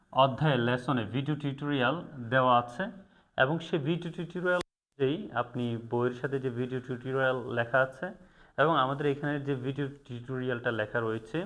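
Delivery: normal talking voice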